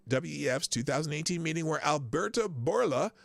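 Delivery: normal talking voice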